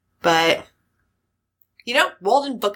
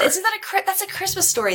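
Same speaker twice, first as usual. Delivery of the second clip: surprised; 11 semitones higher than usual. Real, not acted. Sudden realisation about the film.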